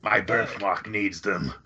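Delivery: slow smoker's villain voice; slow, put-on movie-villain growl; chain-smoking Marlon Brando. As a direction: play the alien boss slowly, savoring each word. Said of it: "chain smoking Marlon Brando with his birthmark".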